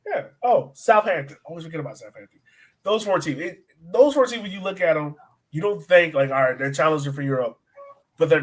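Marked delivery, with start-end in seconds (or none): none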